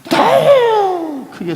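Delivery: very high, long-held cry on 더. Shown as a demonstration. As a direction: stretch 더 into a high, drawn-out yell. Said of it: "소리를 지릅니다". (더를 높고 길게 외치기)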